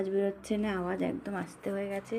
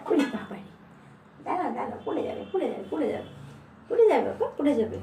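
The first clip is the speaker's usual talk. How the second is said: alarmed warning; 4 semitones higher, 5 dB louder, faster than usual. Real, not acted. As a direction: stop her falling, sharp and urgent. Baby about to fall, alarmed.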